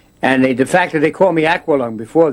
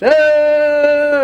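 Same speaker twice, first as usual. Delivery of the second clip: high, loud acted yell; homeless guy scream; 6 dB louder than usual. Showing his movie role to Robin Williams, a high loud yell. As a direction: let out a sudden unhinged shout. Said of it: "screams and yells and like a homeless guy".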